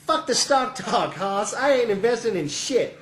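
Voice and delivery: Paul's brusque interruption. Acted. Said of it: "Paul will cut him off saying".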